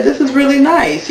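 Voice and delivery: lightly voiced gushing compliment; admiring. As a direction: coo over it, half-imitating. Cast member admiring dress.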